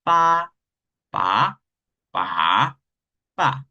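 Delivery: pa said level, then rising, then dipping, then falling; walk pa through each tone in turn; pa in four tones. Shown as a demonstration. Teacher showing the tones of pa. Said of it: "Así tenemos".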